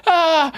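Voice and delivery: high-pitched "ah" of mock horror; mock helpless cry. Acts helpless watchers on the bench.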